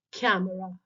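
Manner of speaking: camera said with the first syllable stressed. Shown as a demonstration. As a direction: put the weight on "ca" in camera, the last two syllables light. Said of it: "tell the word again".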